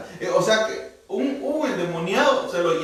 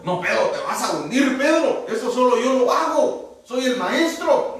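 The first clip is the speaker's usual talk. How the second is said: mock Jesus; 6 semitones higher than usual. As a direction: playfully scold Pedro, half laughing. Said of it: "Pero el Señor le dijo". (Jesús en broma, un poco más alto y riendo)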